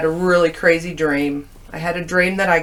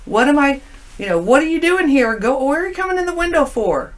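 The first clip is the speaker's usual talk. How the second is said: startled scolding; 7 semitones higher than usual. Retold or imitated. Scolding friend in dream.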